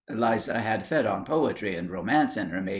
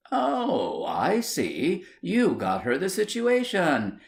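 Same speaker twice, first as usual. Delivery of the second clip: high, pointed sarcasm; sarcastic; 7 semitones higher, slower than usual. Acted. Wife sneering at husband about the girl's job. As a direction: catch him out, sneering.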